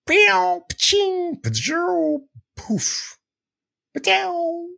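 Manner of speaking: playful string of vocal blast sounds; mouth sound effects. Shown as a demonstration. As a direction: try out cartoon zap and pop noises way up in pitch. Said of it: "What's a poof sound?".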